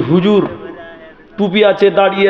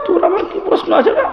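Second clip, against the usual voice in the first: put-on high, teasing put-down of a cheeky boy; mocking preacher to a child; mocking high taunt; 11 semitones higher than usual. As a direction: scoff at the little know-it-all, pitched up.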